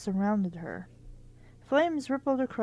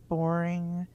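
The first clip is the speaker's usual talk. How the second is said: ordinary delivery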